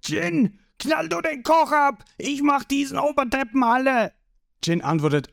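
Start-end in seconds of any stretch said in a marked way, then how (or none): 0.0-4.1 high, strained furious order; enraged boss screaming commands; bursting with rage. A narrator's reading. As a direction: yell it, nearly exploding. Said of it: "Creek platzt fast vor Wut".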